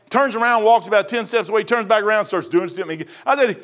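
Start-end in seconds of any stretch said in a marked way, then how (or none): none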